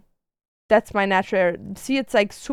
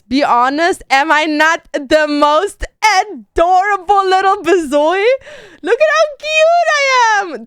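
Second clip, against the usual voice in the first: fishing for compliments; 9 semitones higher, 7 dB louder than usual. Real, not acted. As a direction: coo over your own cuteness. Asks viewers to praise baby photo.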